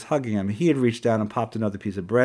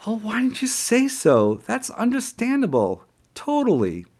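fake understanding; 11 semitones higher than usual. Sarcastic feigned understanding.

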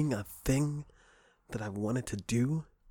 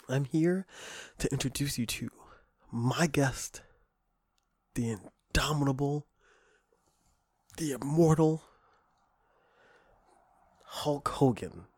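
ring announcer; 3 semitones higher than usual. Acted. Ring announcer introducing guest.

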